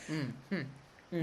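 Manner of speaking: quiet smug little hums; smirky. Retold or imitated. Servant standing up and giving her that face, smirky hums. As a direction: hum softly through an imitated smirk. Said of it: "like, that smirky".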